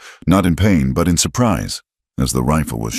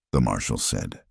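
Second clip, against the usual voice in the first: ordinary delivery, nothing marked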